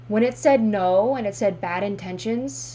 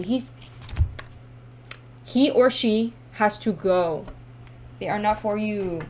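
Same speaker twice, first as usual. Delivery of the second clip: normal talking voice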